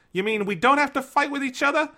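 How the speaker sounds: high, loud cartoon cat voice; a squeaky Meowth pleading loudly. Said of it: "now I'm doing Cockney".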